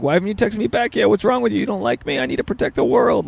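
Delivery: high, pleading mock clinginess; needy codependent. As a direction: whine it like an anxious texter, mocking. Acted needy texts from codependent man.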